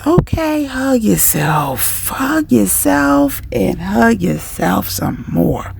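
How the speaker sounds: drawn-out, lingering coaching; slow soothing instruction. Shown as a demonstration. Coach guiding you to hug yourself, slow. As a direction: stretch each hug out.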